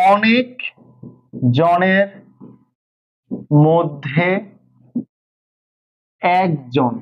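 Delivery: slow dictation with long pauses; word by word. Shown as a demonstration. Teacher explaining the phrase's meaning to students, word by word. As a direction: space each word out for the class.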